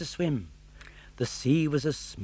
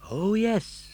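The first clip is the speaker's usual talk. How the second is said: puppet voice; 9 semitones higher than usual. Acted. Pinocchio voiced.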